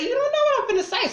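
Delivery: very high, fast frustrated burst; heated squeal; exasperated outburst. Real, not acted. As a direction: rattle it off in disbelief. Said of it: "Why are you yelling".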